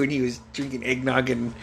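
normal talking voice

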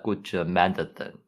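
light Russian accent on "Gochu Manathan". Shown as a demonstration. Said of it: "say it in the Russian accent".

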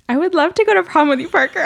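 high, fast, breathy yes; giddy acceptance. Real, not acted. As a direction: accept with giggly delight. Accepting prom invitation.

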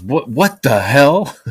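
loud, high, slow startled yell; a dad storming out shouting, slowed right down; angry neighbor dad. Retold or imitated. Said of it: "he goes".

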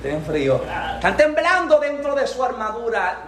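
usual speaking voice

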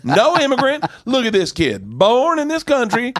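loud, high, hollered approval; red-state fan. Retold or imitated. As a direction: whoop it up like a proud red-state audience member. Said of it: "a lot of the red states going".

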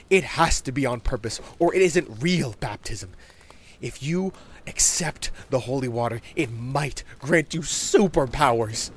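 put-on earnest child, building to a grand promise; insistent young boy. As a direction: lay down the rules with relish. Voices Bobby, insistent.

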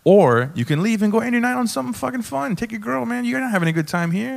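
high-pitched, insistent ushering out; exasperated urging, retold. Comic telling upset man to leave, exasperated urging. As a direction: argue him toward the door, voice lifted.

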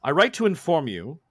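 stately, measured reading; formal letter read. Acted. Messenger reads Lord Holbein's scroll to the crew, formally.

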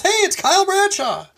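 high mock call across the woods; imitated yell. Retelling a stranger recognizing him, mock yell. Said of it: "someone yells".

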